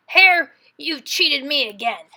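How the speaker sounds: a cheated bear confronting Hare; grumbling accusation; scowling Bear. A narrator's reading. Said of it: "Bear looked at his pile and scowled".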